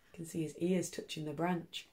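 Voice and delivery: plain reading voice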